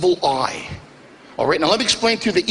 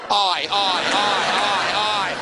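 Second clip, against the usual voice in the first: dismayed groan; slower than usual. Retold or imitated. Comedian groans at the compliment.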